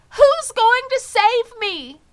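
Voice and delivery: very high, loud, slow eager question; desperate hope. Acted. Wilbur asks Charlotte who will save him, very high and loud. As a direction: plead for an answer.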